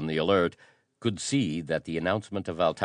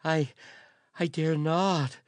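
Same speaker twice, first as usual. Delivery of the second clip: pleading courier; 13 semitones higher than usual. A narrator's reading. Terrified courier begging the prince to spare him. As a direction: plead for mercy, voice pitched up in fear. Courier too afraid to speak.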